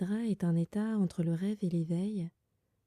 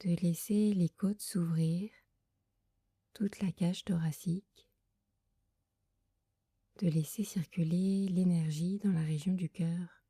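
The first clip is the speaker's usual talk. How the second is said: guided meditation. Acted. Leads a Yoga Nidra meditation. (voix douce et lente de méditation guidée)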